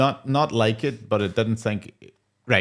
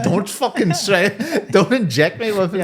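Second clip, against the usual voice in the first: laughing protest; 10 semitones higher, 4 dB louder, slower than usual. Retold or imitated. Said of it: "She's gonna be like".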